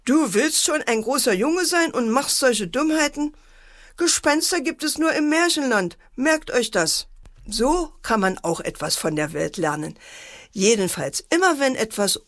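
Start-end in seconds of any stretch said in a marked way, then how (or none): 0.0-7.1 high-pitched, mildly cross scolding; annoyed mother. A narrator's reading. Mother scolding her son over silly pranks, mildly cross. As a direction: scold from higher up, a touch annoyed. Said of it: "Mutter guckte ärgerlich".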